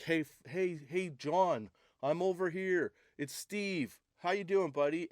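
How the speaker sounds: high-pitched chimp calling a pal; friendly chimp. Acted. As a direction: greet a buddy like a chatty, high-voiced chimp. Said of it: "screeches are just like".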